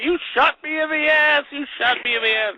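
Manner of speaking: high, comic cry of outrage; mock outraged yell. Zero yelling at Jack after the blank, mock outrage. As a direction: wail it high in hammed-up shock.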